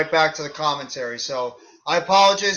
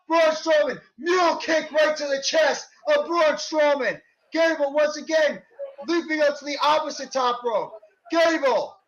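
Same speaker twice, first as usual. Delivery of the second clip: wrestling commentator; 8 semitones higher than usual. Acted. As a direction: shout the big moves like live TV. Live play-by-play of match.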